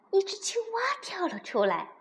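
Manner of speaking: ordinary delivery, nothing marked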